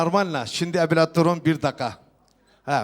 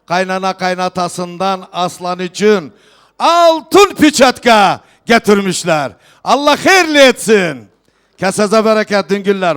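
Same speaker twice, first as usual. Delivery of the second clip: loud festive proclamation to the hall; turkish wedding announcer; 3 semitones higher, 8 dB louder than usual. Real, not acted. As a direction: call out each gift to the guests. Host proclaims gifts at wedding.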